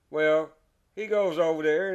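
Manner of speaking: ordinary talk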